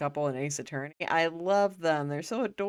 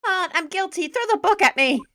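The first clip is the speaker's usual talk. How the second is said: much higher and louder, anguished pleading; wailing nervous man; 13 semitones higher, 9 dB louder than usual. Acted. Ron wailing his guilt to everyone, high and loud. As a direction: beg to be punished, over the top.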